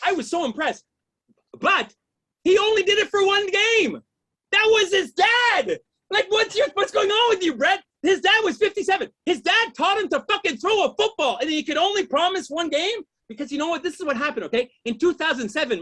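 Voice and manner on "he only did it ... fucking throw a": higher, raised-voice comic indignation; mock outrage. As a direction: berate the quarterback in disbelief. Mock outrage at Brett Favre.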